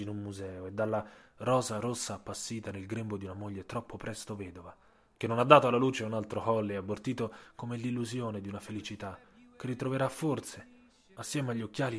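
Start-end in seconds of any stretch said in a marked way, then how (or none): none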